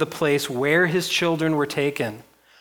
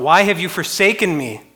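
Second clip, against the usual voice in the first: loud, higher retold cry; Jesus crying out; 6 semitones higher, 8 dB louder than usual. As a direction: cry out the quote from the pulpit. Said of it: "Jesus cries out".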